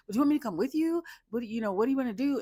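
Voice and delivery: high, loud, fast anxious voice; mock fussing girlfriend. Madison fussing over drunk Joe, high fast worried voice. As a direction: pester him with worry. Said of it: "she was like".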